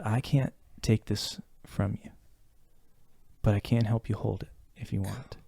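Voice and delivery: hushed, like a hand under the elbows; soft quoted mentor. Retold or imitated. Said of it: "she goes".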